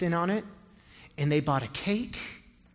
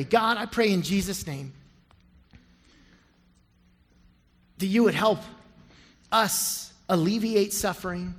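closing prayer. Acted. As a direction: pray it sincerely for the room. Closing prayer over music.

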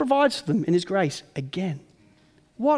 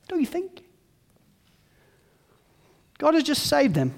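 ordinary talk, nothing marked

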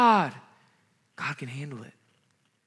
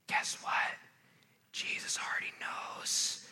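hushed, breathy secret; whisper; 12 dB quieter, slower than usual. Real, not acted. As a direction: lean in and whisper it to the room. Pastor leans in with a secret.

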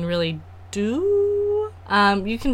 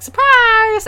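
high, drawn-out "surprise"; spring a mock birthday surprise, pitched way up; singsong party surprise; acted surprise shout; 13 semitones higher, 4 dB louder than usual. Acting out a late birthday surprise.

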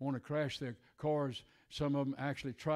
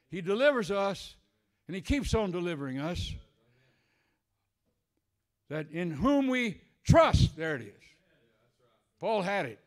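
shouting preacher at full force; preaching; 7 semitones higher, 11 dB louder than usual. Acted. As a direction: whip the church up, loud and high.

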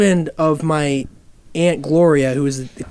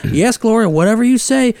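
put-on Southern hillbilly accent; 6 semitones higher than usual. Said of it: "And he was just like".

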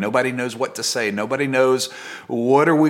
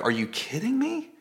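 higher-pitched, incredulous questioning; sarcastic disbelief; 5 semitones higher than usual. Acted. Preacher as doubting fisherman told to recast his net, sarcastic. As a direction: scoff at an absurd suggestion, voice rising. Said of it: "I get a little bit more sarcastic".

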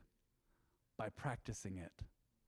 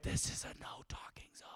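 hushed warning whisper; 14 dB quieter than usual. Retold or imitated. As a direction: shush him with a whisper. Classroom shushing the loud entrant.

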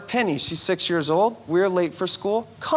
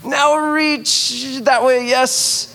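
higher, slow cheery coach; coaching daughter; 8 semitones higher, 4 dB louder than usual. Retold or imitated. Dad leading Penny's morning stretches, high slow cheer. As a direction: lead a child's stretch like a peppy trainer. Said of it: "And I said".

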